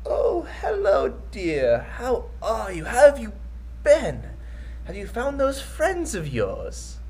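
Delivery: put-on frail old man, high and slow; quavering elderly shopkeeper voice. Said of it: "It's this super frail old man".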